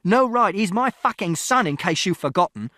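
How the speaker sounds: high, loud, repeated bellow, fading at the end; enraged shout. A narrator's reading. Father to mother over his son, enraged shout. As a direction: throw her words back in fury. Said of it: "And Father shouted".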